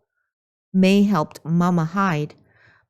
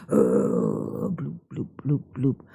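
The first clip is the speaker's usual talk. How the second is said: groan and bloops; 4 dB quieter than usual. Acted. Nian sinks again.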